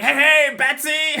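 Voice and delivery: loud, high villain taunt; hammed-up shout to a foe; calling out. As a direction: holler it like a gleeful movie madman. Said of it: "He's like".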